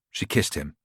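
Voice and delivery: usual speaking voice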